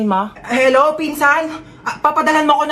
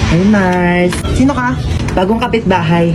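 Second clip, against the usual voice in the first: louder, brassy neighborly banter; loud brash neighbor; 2 semitones lower, 6 dB louder than usual. Acted. Nosy neighbors loudly greeting the newcomer with food. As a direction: holler across the yard.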